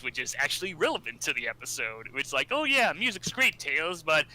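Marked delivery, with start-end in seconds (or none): 2.5-4.3 Sonic hero voice. Retold or imitated. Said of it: "we get our Sonic Says".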